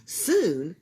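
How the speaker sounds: ordinary delivery, nothing marked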